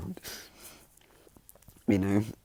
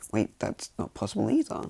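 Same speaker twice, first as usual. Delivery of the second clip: higher-pitched incredulous objection; disbelief; 4 dB louder than usual. Acted. Mother doubting the doctor's claim, incredulous. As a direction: voice a mother who can't believe it. Voicing Inko's disbelief in retold story.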